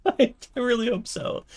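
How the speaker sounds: high giggly repeat, laughing. Real, not acted. Laughing repeat, much higher.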